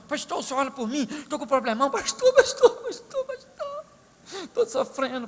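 high, wailing pleas of pastor; hammed-up wailing sister; begging sister. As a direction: plead like a desperate church lady. Sister begs pastor after service.

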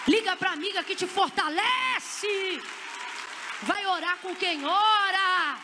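high, shouted exhortation with long held endings; fervent slow shouted preaching; full-force preaching. Real, not acted. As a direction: build to a roaring pulpit climax.

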